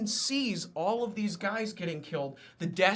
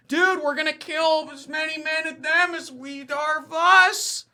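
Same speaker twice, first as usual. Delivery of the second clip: higher, louder exasperated officer voice; mock artillery officer; 9 semitones higher, 6 dB louder than usual. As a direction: protest to your general in a strained, raised voice. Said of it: "the artillery general is just like".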